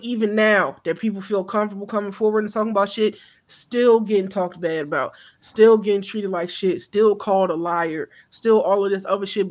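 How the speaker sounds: usual speaking voice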